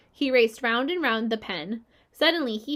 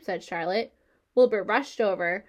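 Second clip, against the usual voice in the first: usual speaking voice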